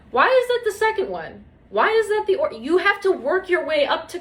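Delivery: can't believe what you're hearing; exasperated high-pitched disbelief; incredulous. Real, not acted. Incredulous rant at the doctor.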